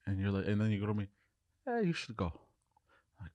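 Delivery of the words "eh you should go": soft, higher-pitched offhand advice; hushed, lifted dream voice; imitated dream stranger. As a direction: quietly wave him away, imitating the stranger.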